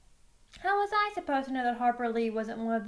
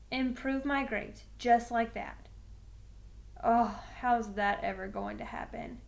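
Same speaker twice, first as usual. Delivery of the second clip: ordinary delivery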